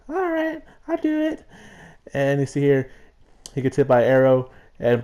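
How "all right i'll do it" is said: very high, soft squeaky agreement; squeaky pet voice. Acted. Gander Junior agreeing to be a shield, very high squeak. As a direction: agree like a tiny nervous critter. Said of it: "He's like".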